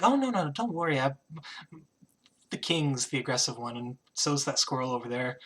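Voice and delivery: soft, casual reassurance from a queen; dismissive queen. Acted. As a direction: wave the worry away quietly. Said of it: "she just kind of waves her hand. She's like".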